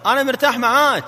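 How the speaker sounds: very loud and high, projected. Shown as a demonstration. Trainer urges husband to raise his voice, loud high model. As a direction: raise the voice right up. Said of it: "على صوتك أكثر".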